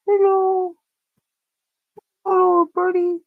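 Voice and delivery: high cooing hello; sing-song hello to a chick. Real, not acted. Greeting the baby bird.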